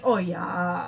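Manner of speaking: thick Wisconsin oh yeah; long Minnesota-style O; Wisconsin accent. Retold or imitated. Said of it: "my mom has a really thick accent".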